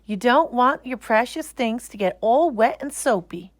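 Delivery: a mother fussing over her daughter; mother's voice. Acted.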